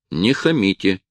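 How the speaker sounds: usual speaking voice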